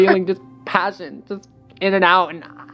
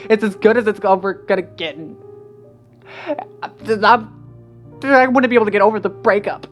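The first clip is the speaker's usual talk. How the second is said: higher and louder, words tumbling out in distress; distraught outburst, higher and louder; spurting out; 8 semitones higher, 10 dB louder than usual. A narrator's reading. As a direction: pour it all out, rising toward tears. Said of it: "she spurted out suddenly".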